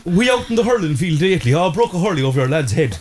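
lightly voiced southern Irish lad, a bit high; chatty hurling lad in southern Irish accent; excited southern Irish accent. Host keeps mimicking a southern Irish talker, mild southern Irish accent. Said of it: "everybody gets really excited".

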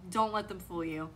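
usual speaking voice

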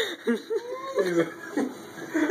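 shrill helpless giggle; high squealing laugh. Real, not acted. Friend choking on cinnamon.

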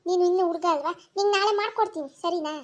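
ordinary delivery

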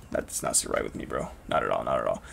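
very low, soft grumble; disapproving mutter. Real, not acted. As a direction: grumble it under your breath. Disappointed about dropped accent.

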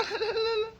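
high, soft laughter; amused giggle. Real, not acted. Vlogger sees powder on the child's face, high giggle. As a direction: giggle helplessly, high and quiet.

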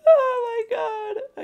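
slow, high, half-laughing half-crying oh my god; shaky laugh. Real, not acted. Player recovering from a scare, laughing shakily. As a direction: let the shock come out as a wobbly laugh. Shaken after attack.

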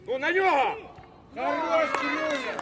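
loud drawn-out sideline yell; shouted encouragement. Real, not acted. Coach urging Кирюня at the opponent, shouting. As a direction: cheer the player on at full voice. Coach urges and praises a player.

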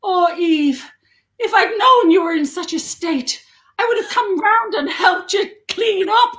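high, loud mock-appalled old relative; partner's Irish nan. Partner's nan seeing the messy flat, hammed-up dismay. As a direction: imitate a scandalised nan, pitch climbing. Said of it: "I can't do an Irish accent, but she went".

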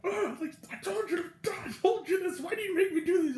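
high, laughing imitation of exasperated scolding; mock beating rant. Guard beating up the puppet, high mock exasperation. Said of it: "just beating the poor thing up".

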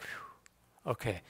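soft exhaled sigh, dropping low; hushed relief. Retold or imitated. Parent finding the injured son alive, quiet relief. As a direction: breathe out the worry, very quietly.

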